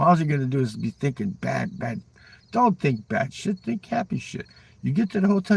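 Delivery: ordinary talk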